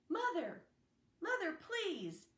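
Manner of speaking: high, desperate call across a distance. A narrator's reading. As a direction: cry out for his mother, pleading. Boy calling out to woman.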